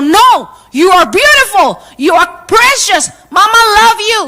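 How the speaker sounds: mother fighting the hurt with passion; insistent, fighting. Retold or imitated. Mother insists to Zoe she is beautiful, fervent. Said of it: "saya fight".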